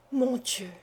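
soft, stricken murmur; a hushed despairing murmur. A narrator's reading. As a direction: breathe it out quietly in shock. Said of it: "murmura Fourcy".

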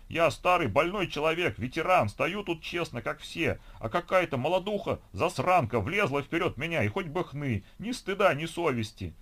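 put-on high-pitched, ranting old complainer; aggrieved old veteran. A narrator's reading. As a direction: wail indignantly about being pushed aside. Aggrieved old person rants in the queue.

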